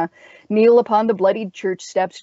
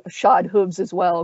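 usual speaking voice

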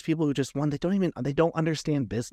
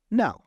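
a high, loud, flat refusal; emphatic no; 7 semitones higher, 4 dB louder than usual. Real, not acted. Refusing the artist again, high and emphatic. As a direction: slam the door with one word.

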